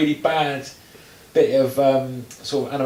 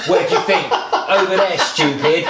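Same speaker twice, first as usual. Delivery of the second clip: higher, louder mock delivery of a wooden actor; mocking Beckham; 6 semitones higher, 4 dB louder, slower than usual. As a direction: ham up the stiff line, a touch louder. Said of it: "he's just like".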